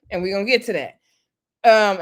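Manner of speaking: usual speaking voice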